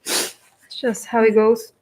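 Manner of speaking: talking with a runny nose; sniffly, nose running. Real, not acted. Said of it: "Excuse my nose running".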